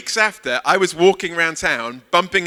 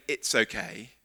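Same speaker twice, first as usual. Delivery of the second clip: softer, brushing it off quickly; dismissive okay; 6 semitones lower, 10 dB quieter, slower than usual. Shown as a demonstration. Preacher showing the congregation empty tolerance, lightly dismissive. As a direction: wave it away lightly. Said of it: "forgiveness is not saying".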